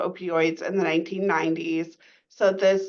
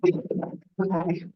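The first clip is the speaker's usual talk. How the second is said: muffled, underwater-sounding mic; like talking from underwater. Real, not acted. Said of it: "It's really muffled".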